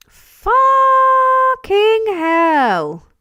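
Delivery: very long, loud, high-pitched outburst; stunned exclamation. Real, not acted. Streamer reacting to a two hundred pound super chat, stunned. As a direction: stretch each word out in loud amazement.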